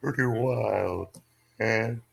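slow, speaking on the in-breath; talking while inhaling. Shown as a demonstration. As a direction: draw breath in while saying it. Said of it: "See, I do something different".

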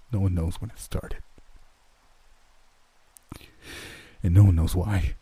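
halting, lightly quivering voice; quivering, held-back emotion. A narrator's reading. Dale composes himself to explain, holding back emotion. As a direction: hold the grief back between long pauses. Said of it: "his voice quivering with held-back emotion".

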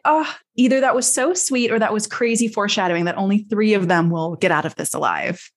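ordinary talk

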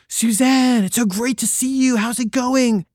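high, gushing fake cheer; overly friendly. Narrator greeting Suzanne to escape a bad date, gushing high. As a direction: greet her like a long-lost friend, gushing. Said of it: "I was like".

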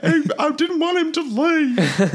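very high, drawn-out Southern wail; mock crying. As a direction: sob it out, hammed-up and slow. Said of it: "like, crying and, like, really selling it".

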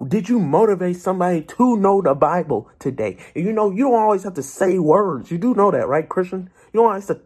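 rally the faithful to live as light; preaching at full force. Acted. Preacher urging his online flock, full-force preaching.

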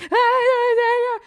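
high, loud, wailing yeahs; imitating herself sobbing on the phone; mock crying wail. On the phone to Danny, crying over the lost cat, wailing. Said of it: "I'm, like, losing my mind".

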